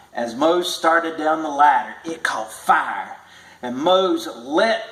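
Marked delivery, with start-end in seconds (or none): none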